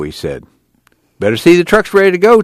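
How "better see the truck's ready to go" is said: quick, high-pitched warning. A narrator's reading. Tom telling Al to check the truck, quick warning. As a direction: call out a caution, fast and raised in pitch. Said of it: "Tom warned him".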